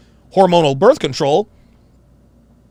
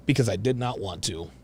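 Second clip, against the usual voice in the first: mocking; 8 semitones lower, 12 dB quieter than usual. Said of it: "mimicking the guest".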